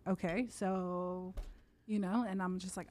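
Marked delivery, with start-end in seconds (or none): 0.1-1.3 slow, drawn-out doubtful okay; mom's skeptical look. Retold or imitated. Her mom reacting to the fight, slow and doubtful. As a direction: let it hang like an unimpressed mom. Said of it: "my mom's looking at me like".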